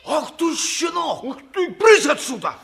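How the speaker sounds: angry yell. Acted. Hunter yells at the cheeky boy: furious shout. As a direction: blow up at the brat.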